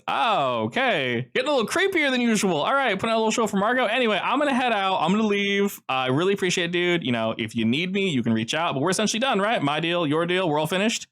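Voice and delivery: high, quick nervous wrap-up; Joe wrapping up. Acted. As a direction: hurry out, keep it light.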